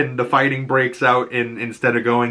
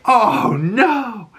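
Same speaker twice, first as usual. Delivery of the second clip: much higher, mock-horrified cry; dismayed; 11 semitones higher than usual. Recapping Achilles' death, own high dismayed reaction.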